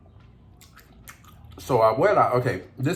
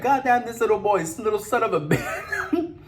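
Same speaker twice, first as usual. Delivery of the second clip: high mock screech breaking into a laugh; furious grandma; 10 semitones higher than usual. Translating grandma's curse to viewers, high mock yell with a laugh. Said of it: "she's like".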